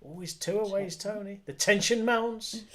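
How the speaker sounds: ordinary talk, nothing marked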